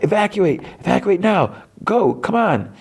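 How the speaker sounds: very high mock yell, volume held back; urgent high-pitched shout, restrained; retold scream. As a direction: imitate screaming orders to the others. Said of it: "May screams to the others".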